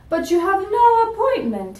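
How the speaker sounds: high, clipped refusal; stern dentist. Acted. Fussy dentist turning away a patient. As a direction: brush her off with a prim high voice.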